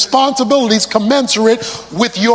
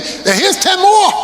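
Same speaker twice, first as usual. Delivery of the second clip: excited shout; 8 semitones higher than usual. Acted. Servant boasting doubled money.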